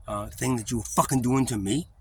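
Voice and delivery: ordinary delivery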